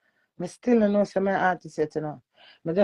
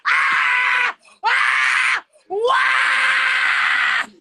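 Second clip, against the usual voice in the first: high, loud screaming cries in the song; sung scream; 13 semitones higher, 9 dB louder, much slower than usual. Acted. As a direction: belt out the screams wide open. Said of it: "just scream and shout and say".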